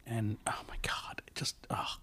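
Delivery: hushed, disgusted whisper. Real, not acted. Reacting to gruesome quote.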